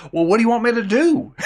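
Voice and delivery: Hobie Doyle. Retold or imitated. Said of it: "He talks like this".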